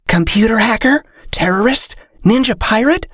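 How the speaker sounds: breathy, high fairy guesses; whisper-chirp each guess. Acted.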